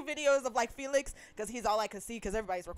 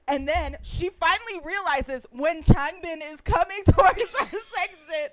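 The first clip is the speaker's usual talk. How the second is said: loud, high, breaking into laughter; real laughing; 6 semitones higher, 7 dB louder, slower than usual. Real, not acted. Fan retelling her friend finally noticing [name], laughing loudly. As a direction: laugh your way through the story, slowing down.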